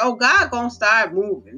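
ordinary delivery

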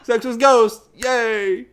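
high, loud whoop of mock delight; mock cheering. Joking about sex with ghosts, mock excited cheering. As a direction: cheer like a party fan.

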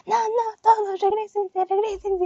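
mock police order. Imitating hooded police ordering partygoers back inside, a high mock command. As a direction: play the armed cop barking them back, hammed up.

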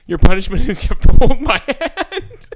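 high, loud hammed-up teacher; teacher's mock sternness. Teacher punishes misbehaving student, hammed-up loud high voice. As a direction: announce a silly punishment grandly.

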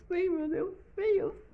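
very high, sobbing voice; fake-cry with joy; mock crying. Overwhelmed by praise, mock sobbing.

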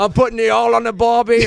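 high, loud fake Aussie; hammed-up Australian barbecue talk; badly faked Australian accent; mock Australian accent. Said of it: "I don't know your terms".

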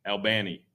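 Albany said "al-BANN-ee"; Albany with BAN stressed and a flat A; Georgia pronunciation. Shown as a demonstration. Host repeating the local name, Albany as "al-BANN-ee". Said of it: "that's how it's pronounced".

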